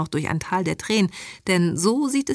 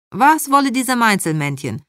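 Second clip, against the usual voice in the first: higher, louder puzzled mother voice; baffled immigrant mother; 5 semitones higher, 6 dB louder than usual. Acted. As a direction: ask it as the mother, pitched up and loud.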